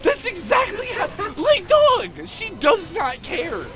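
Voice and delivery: high, loud, emphatic yelling; excited. Real, not acted. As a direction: shout it, fired up in argument. Heated debate peak.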